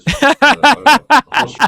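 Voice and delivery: words dissolving into a loud, high laugh. Real, not acted. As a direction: crack up while trying to finish. Breaks into laughter mid-sentence.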